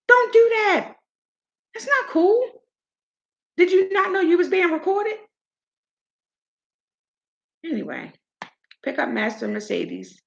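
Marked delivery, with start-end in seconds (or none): 0.1-5.2 high, heated scolding; real indignant reproach; fired up. Real, not acted. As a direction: tell them off with pitch way up. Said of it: "I'm all fired up".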